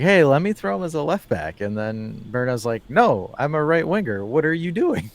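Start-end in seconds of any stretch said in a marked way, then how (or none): 0.0-1.6 raised pitch, louder quoted pitch to a colleague; lightly acted coach floating a casual idea; mock breezy suggestion. Retelling a coach's odd idea to co-hosts, mock breezy. 2.9-5.1 higher-pitched, incredulous player objecting; mock indignant protest. Voicing a right winger objecting to his coach, mock indignant.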